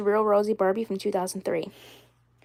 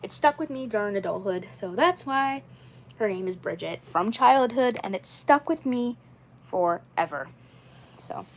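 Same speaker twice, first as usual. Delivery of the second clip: ordinary talk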